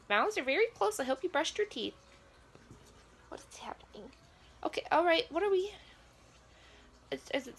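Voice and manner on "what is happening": softer, breathless whisper; shocked whisper. Real, not acted. Viewer watching a near kiss, whispering in shock. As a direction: whisper it like you can't look away.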